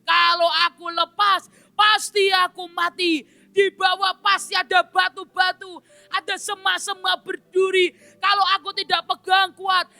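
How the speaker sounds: high, strained voice of a frightened man; panicky plea. Acted. Om Kristen clinging to the branch, high frightened pleading. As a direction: plead as if about to fall. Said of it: "Dan dia berkata".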